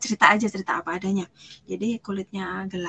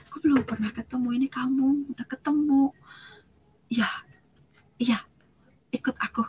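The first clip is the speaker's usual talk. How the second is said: raised, emotional voice breaking into short pauses; acted tearful plea; tearful retelling; 4 semitones higher than usual. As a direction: imitate someone speaking through tears. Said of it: "Begitu dia nangis, dia bilang".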